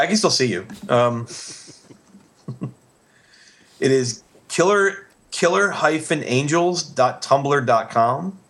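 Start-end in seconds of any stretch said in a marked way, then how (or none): 3.8-8.4 slow, spelled-out web address; dictating. Shown as a demonstration. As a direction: read the address slowly for listeners. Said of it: "let me just click away".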